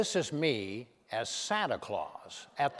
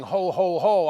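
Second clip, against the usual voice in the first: Santa ho ho ho; 4 semitones higher, 10 dB louder than usual. Retold or imitated. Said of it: "instead of Santa going".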